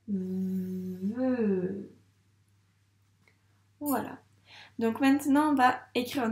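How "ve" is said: "Ve" with a very long v gliding into held e; sounded-out syllable. Shown as a demonstration. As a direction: buzz through the v of "Ve" as if tracing it. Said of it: "donc on doit le dire, les sons".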